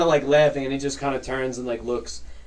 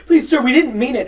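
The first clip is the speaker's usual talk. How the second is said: high, loud begging; acted panicked mother; desperate plea; 11 semitones higher, 10 dB louder than usual. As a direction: beg the officer for mercy. Mother begs the captain.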